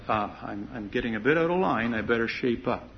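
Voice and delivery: put-on hushed, a bit hurried inner voice of a caught child; child's sheepish thought. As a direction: mutter it to yourself like a kid who knows he's slipped.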